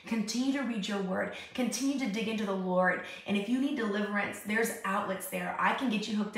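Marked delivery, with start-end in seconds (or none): none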